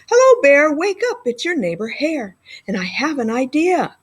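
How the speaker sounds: bright wake-up call. Acted. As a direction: rouse the sleeping bear. Hare calling to wake Bear.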